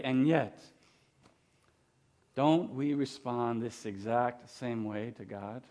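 ordinary talk, nothing marked

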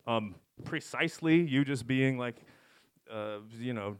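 ordinary talk

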